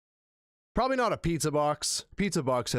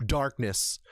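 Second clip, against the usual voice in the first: ordinary delivery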